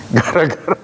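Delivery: words broken up by a chuckle; laughing. Real, not acted. Preacher amused at the scholar's self-made prayer, laughing.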